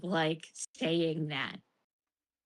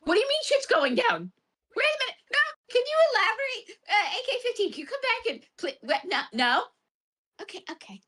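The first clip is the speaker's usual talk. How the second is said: much higher, frantic confusion; baffled; 13 semitones higher than usual. Retold or imitated. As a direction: squeak out the panic of being lost. Retelling own confused reaction.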